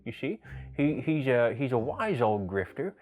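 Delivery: slurred sideways-mouth hobo voice; old hobo grifter. Shown as a demonstration. Wise old hobo giving advice to the characters, side-mouth slur. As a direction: talk out of the side of your mouth. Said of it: "move my lips to the side, I'm gonna start to sound like this".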